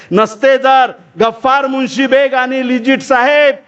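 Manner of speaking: slow, high-pitched declaimed verse; poem recitation. Acted. Orator repeating Savitribai's line of thanks, slow high declamation. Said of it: "सावित्रीबाईच्या हस्ताक्षरात लिहिलेली कविता आहे".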